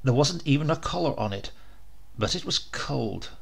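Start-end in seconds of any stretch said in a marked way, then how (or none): none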